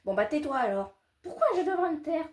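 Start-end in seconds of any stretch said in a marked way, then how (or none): 1.2-2.3 put-on indignant child. High child voice arguing.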